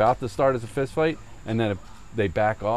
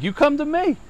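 very high acted holler; mock counter-challenge; 4 dB louder than usual. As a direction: fire back a dare in a raised imitation voice.

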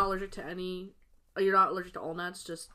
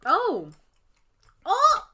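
surprised cry; 11 semitones higher, 10 dB louder than usual. Real, not acted. Reacting to a taste.

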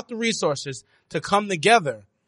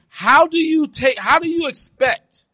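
emphatic; 8 semitones higher, 5 dB louder, slower than usual. Real, not acted. Heated podcast debate.

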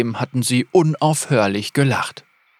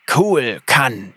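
slow lisped name; lisping reptile voice; slower than usual. A narrator's reading. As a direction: hiss out the name slowly. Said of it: "seltsamen Lispeln".

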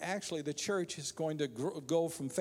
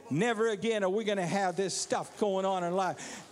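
impassioned preaching; 4 semitones higher than usual. Real, not acted. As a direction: drive it home to the congregation, rising in pitch. Preaching builds to a climax.

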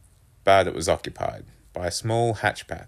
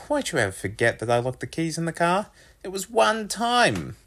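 whining complaint; 11 semitones higher than usual. A narrator's reading. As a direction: whine at Mum, climbing higher. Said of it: "My voice was whining with complaint".

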